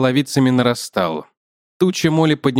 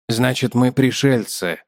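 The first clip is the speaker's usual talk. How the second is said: ordinary delivery, nothing marked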